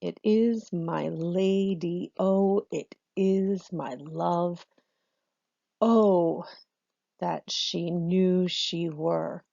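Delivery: very slow, each syllable weighed; slow scansion read-through; scanning aloud. Shown as a demonstration. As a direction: speak the verse beat by beat while marking. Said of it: "I'm gonna speak it as I do it".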